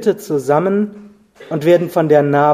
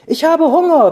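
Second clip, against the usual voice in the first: high, tiny squeaking mouse voice; squeaky mouse; 11 semitones higher, 3 dB louder than usual. A narrator's reading. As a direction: squeak it like a small hungry mouse. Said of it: "piepste die Maus".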